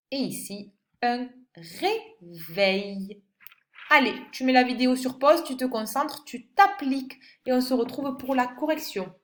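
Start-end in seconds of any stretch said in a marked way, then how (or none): 1.0-3.2 "réveil" drawn out very slowly; slow stressed word. Shown as a demonstration. Teacher to pupil, naming the picture slowly. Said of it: "helping with the pictures".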